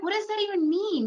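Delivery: a baffled, high-pitched question; incredulous. Retold or imitated. Replying to traders about long candlesticks, incredulous high pitch. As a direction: pitch leaps way up in disbelief. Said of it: "And I'm like".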